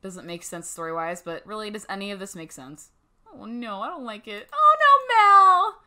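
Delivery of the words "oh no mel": very high, loud panicked cry; real alarmed shriek. Real, not acted. Player panicking as Mel falls asleep, loud shriek. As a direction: yelp as it goes wrong.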